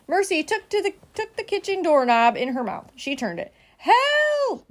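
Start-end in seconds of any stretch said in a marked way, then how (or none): 3.8-4.7 high drawn-out distress call; acted cry for help. A narrator's reading. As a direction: call out for rescue. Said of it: "Missus Watson called again".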